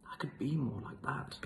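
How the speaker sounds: whispered wish; wistful whisper. Acted. Mouse wishing he were like the lion, whispered. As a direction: breathe it as a secret longing. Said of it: "thought the mouse".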